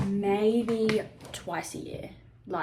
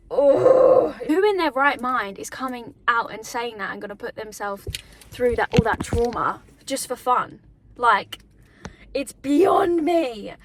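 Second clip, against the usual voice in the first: louder, rising disbelief, slowing on "beyond me"; indignant outburst; 2 semitones higher, 8 dB louder, slower than usual. Real, not acted. To her viewers, indignant that victims face the trauma, louder and slowing. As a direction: let the outrage build to the end. Outraged about assault victims.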